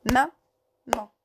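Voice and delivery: "Maman" coupé en deux syllabes, ma-man; détache bien ma et man. Shown as a demonstration. (maman syllabe par syllabe)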